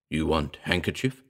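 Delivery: low, soft ape talking pidgin; bestial ape voice. A narrator's reading. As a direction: speak low like a beast with human words. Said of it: "The intonation was bestial".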